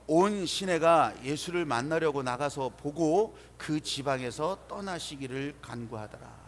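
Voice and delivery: slow, drawn-out unison reading; scripture reading. Acted. Pastor leading everyone in reading together, slow scripture recitation. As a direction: lead the verse slowly and firmly, easing off at the close. Reads scripture aloud with congregation.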